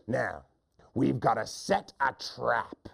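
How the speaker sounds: mean hunter. Acted. Hunter instructing his silly friend, slow mean voice. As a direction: lay out the plan slowly. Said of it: "The first hunter says".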